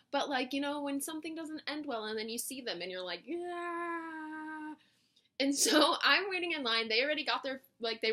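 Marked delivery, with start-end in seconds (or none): 3.2-4.7 long, high, held-out noise; awkward dread. Retold or imitated. Reacting to seeing people after a bad ending, drawn-out awkwardness. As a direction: stretch it out high and softer, uneasy.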